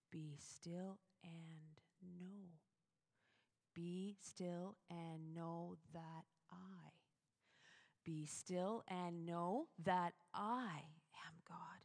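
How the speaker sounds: slow, hushed whisper of the verse. Shown as a demonstration. Preacher whispering the psalm to her congregation, slow and hushed. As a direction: breathe the words like a still small voice. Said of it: "still, small voice or whisper".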